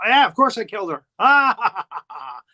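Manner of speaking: mock maniacal confession with a loud cackle; hammed-up lunatic; playing crazy. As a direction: burst out, then cackle like a movie madman. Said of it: "plays the crazy".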